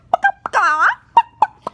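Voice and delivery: loud clucking; chicken cluck. Pretend. Child playing chicken, loud clucks. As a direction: cluck like a hen.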